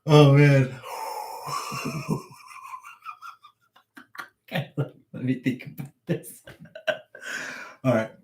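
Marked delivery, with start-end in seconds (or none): 0.8-3.3 long, breathless high laugh; wheezing with real laughter. Real, not acted. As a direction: dissolve into helpless laughter. Cannot keep a straight face.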